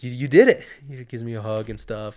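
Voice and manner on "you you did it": loud burst of congratulation; cheering. Retold or imitated. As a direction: shout it like he's proud of you. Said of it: "he's like".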